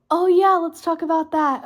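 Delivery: just remembered something exciting; excited. Real, not acted. Sudden idea about the podcast.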